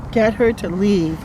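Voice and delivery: usual speaking voice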